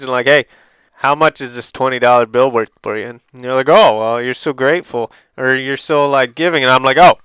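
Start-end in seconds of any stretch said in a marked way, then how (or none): 0.3-3.1 taunting, loud. Pretend. Taunting the beggar. 3.7-6.6 mock flattering beggar. 7.0-7.2 loud mocking taunt.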